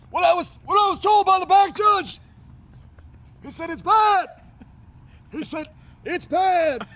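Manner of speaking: high, strained, shouting coach; hammed-up anguished squawk, straining the vocal cords; Ron Zook. Coach recounting the back judge's bad call, loud high mock wail. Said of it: "have you ever heard Ron Zook talk".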